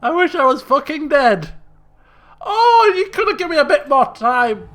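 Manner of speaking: loud, high despairing cry; real loud despair; real frustration. Real, not acted. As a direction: cry out at the game. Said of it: "player frustrated at game".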